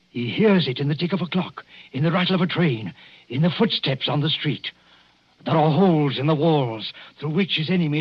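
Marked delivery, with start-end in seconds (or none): none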